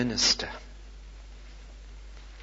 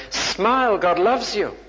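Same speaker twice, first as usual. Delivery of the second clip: higher, louder slogan delivery; joyful proclamation; 8 semitones higher, 5 dB louder, slower than usual. Acted. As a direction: proclaim it as wonderful news. Quotes a joyful slogan to congregation.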